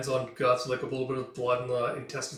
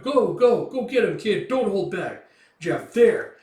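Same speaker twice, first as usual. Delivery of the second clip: loud, high cheering from the crowd; rowdy cheering; 8 semitones higher, 9 dB louder, slower than usual. Acted. Watching mercenaries cheering on Guts, rowdy loud cheering. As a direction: egg the kid on.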